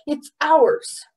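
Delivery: high, loud, breathless insistence; winded protest; panting. A narrator's reading. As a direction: gasp it out, insisting. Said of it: "panted Pong".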